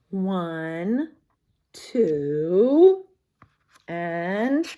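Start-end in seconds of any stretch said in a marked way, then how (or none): none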